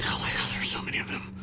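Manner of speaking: whispered aside; breathy stage whisper. Real, not acted. Whispered aside about sequels.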